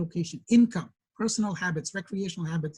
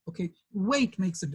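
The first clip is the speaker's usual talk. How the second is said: ordinary talk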